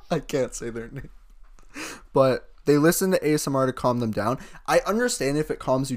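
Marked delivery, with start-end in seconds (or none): 0.0-1.1 talking through a laugh. Real, not acted. Laughing about the friend they can't name, amused. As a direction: get it out while cracking up.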